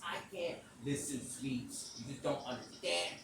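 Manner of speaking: ordinary talk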